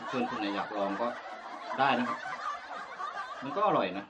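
eating and speaking between bites; talking while chewing. Real, not acted. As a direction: mouth partly full of food. Seen 2: Bitten tomato in hand; lips pursed, jaw working between words.

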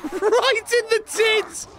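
high, slow squeal of triumph; falsetto cheer. Pretend. Landing a hit on the orc, squealed slowly up high. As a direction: stretch it out in a shrill high voice.

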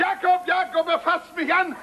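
high, indignant call for help; alarmed outcry. Acted. Father accuses son before Jakob, alarmed outcry.

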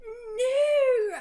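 high, loud hammed-up scream; sexy scream. Ebony wakes from her vision, hammed-up scream. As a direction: throw out a breathy mock-dramatic cry. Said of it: "I screamed sexily".

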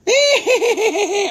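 high, loud wicked witch cackle. Acted. Invokes Wicked Witch of the West.

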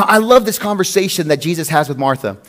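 ordinary talk

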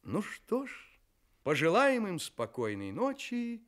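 normal talking voice

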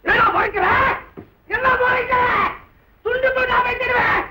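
high, shouted threats; furious threat. Acted. Husband threatening the man who glares at him, furious. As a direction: threaten him at full pitch.

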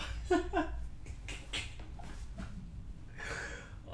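a long high airy laugh; high breathless laugh. Real, not acted. Celebrating with partner, a long high laugh. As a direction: laugh it out in relief. Release after big moment.

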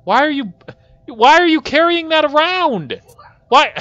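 high-pitched, loud incredulous outburst; exasperated yelling. Real, not acted. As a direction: yell in disbelief at a useless helper. Yells at character carrying asteroid chunk.

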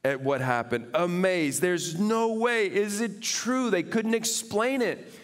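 high, loud, fervent preaching; full-force preaching. Acted. Pastor preaching to the congregation, fervent and loud. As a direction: preach it out to the whole room.

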